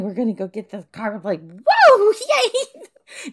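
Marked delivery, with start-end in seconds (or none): 1.4-3.3 loud, high joyride cheer; exhilarated whoop. Acted. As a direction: whoop and laugh with delight. Thrilled as the car drives off.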